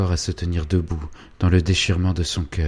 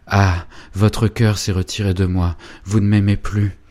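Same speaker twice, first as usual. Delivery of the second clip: louder, hurt reproach; wounded lover; 3 semitones higher, 6 dB louder than usual. Acted. Félicien reproaching Angélique, hurt and raised. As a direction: accuse her of loving him no more.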